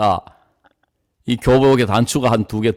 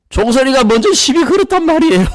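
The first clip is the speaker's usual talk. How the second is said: protesting son; 11 semitones higher, 3 dB louder than usual. Retold or imitated. Son protests, voiced by father.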